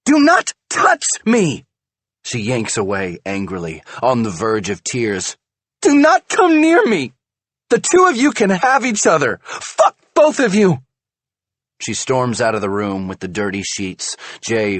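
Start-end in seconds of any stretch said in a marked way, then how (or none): 0.0-10.8 high, loud furious scream. A narrator's reading. Wife screams at husband not to touch her, furious. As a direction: scream him away near tears.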